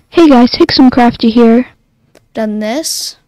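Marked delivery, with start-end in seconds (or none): 0.1-1.7 much louder recorded intro; sample played on a button; loud playback. Shown as a demonstration. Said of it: "I've done this".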